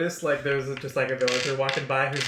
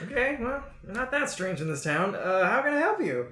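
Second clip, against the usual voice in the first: a hint of a higher shopkeeper voice; lightly pitched-up, accepting shopkeeper; lightly voiced blacksmith; 8 semitones higher than usual. Blacksmith receives adventurers as customers, lightly raised voice. Game master plays the blacksmith.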